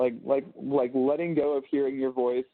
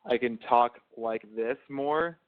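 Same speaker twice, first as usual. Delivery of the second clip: professional voice; 3 semitones lower than usual. Shown as a demonstration. Man showing partner his more direct talking voice, professional. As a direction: speak low and direct, taking your time. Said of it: "my professional voice".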